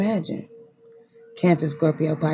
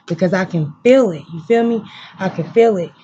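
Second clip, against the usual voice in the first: choked up; 3 semitones higher, 7 dB louder than usual. Real, not acted. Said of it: "y'all not gonna have me here crying".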